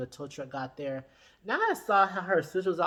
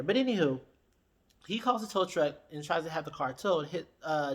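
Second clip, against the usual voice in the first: ordinary talk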